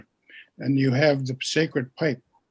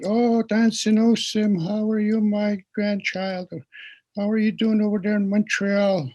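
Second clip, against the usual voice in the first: higher, lightly voiced chatty grandson; imitating a grandson; 6 semitones higher, 3 dB louder than usual. Storyteller mimicking a grandson greeting his grandmother on the phone. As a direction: play the Mi'kmaq friend phoning his grandmother, lightly. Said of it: "He says".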